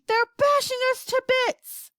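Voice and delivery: high, loud panicked report; shouting. A narrator's reading. Astronaut being battered in the capsule, shouting. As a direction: shout it as if under attack. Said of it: "Shuckworth was shouting".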